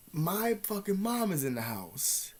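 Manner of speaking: ordinary talk, nothing marked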